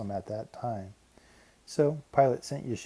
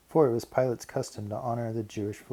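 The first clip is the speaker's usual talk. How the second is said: plain reading voice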